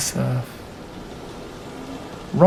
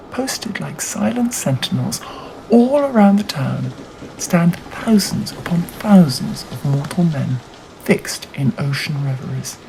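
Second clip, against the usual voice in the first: ordinary delivery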